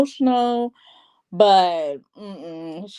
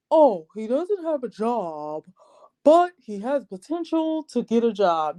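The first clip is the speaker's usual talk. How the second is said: mimicked excuse. Mimicking the experts' excuse for Steve.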